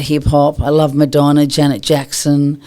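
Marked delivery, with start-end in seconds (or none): none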